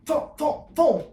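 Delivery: high, mock thumps for each pop; comic thump noises. Shown as a demonstration. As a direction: imitate the jolt of a pop. Imitating collar pop sounds.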